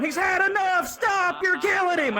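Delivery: high, loud mock yell from above; yelling bystanders. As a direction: shout down from the balcony. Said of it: "are yelling".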